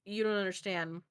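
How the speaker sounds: normal talking voice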